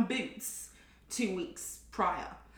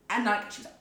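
ordinary delivery

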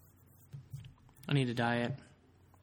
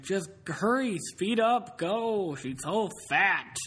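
put-on much higher, louder drunk urging, slow at the end; frantic drunk; 7 semitones higher, 3 dB louder, much slower than usual. Mimicking drunk friend frantically telling her to speed away. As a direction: ham up the panicky drunk. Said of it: "And he's like".

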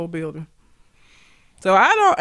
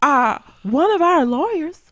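lightly mimicked speech-maker, higher pitch; Roy Moore's wife; 6 semitones higher than usual. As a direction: mimic her indignant little speech, pitched up. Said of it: "then you had his wife saying".

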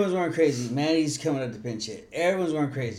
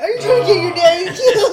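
put-on much higher, louder laughing child voice; imitating daughter, laughing; 13 dB louder than usual. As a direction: voice the scolding little girl through giggles. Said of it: "I'm like".

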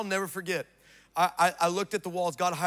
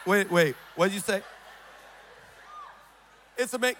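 usual speaking voice

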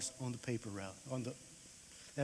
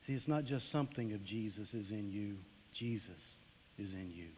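softer, lower preaching application; preacher applying the story to the congregation, softer; quiet preaching close; 7 semitones lower, 11 dB quieter than usual. Acted. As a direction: bring it home gently to the congregation. Closing sermon application.

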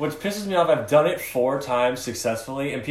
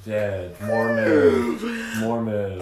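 drawn-out, mock mournful intoning; mock solemn tribute; 2 semitones lower, much slower than usual. Co-host marking a mock moment of silence, solemn tribute. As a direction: stretch the word out like a joke memorial. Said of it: "Moment of silence".

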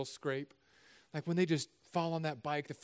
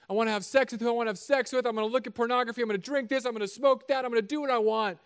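raised, pleading sinner; loud pleading voice; 7 semitones higher, 5 dB louder than usual. Retold or imitated. Sinner defending his lifestyle to the preacher, loud and defiant. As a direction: protest loudly like a defiant sinner. Man voicing a sinner's plea.

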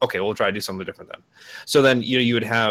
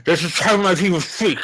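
mock character voice; 7 semitones higher, 6 dB louder than usual. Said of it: "mocking a character's line".

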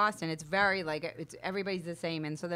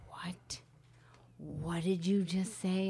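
put-on hushed, shocked boyfriend; 2 semitones lower, 6 dB quieter, much slower than usual. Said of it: "he goes".

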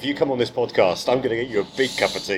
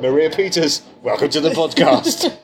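higher and louder, slowing with a laugh; grand welcome; 5 semitones higher, 7 dB louder than usual. Acted. Host welcoming Maria Peters onto the podcast, big announcer style. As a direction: announce the guest like a showman.

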